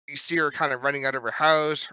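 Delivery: ordinary talk, nothing marked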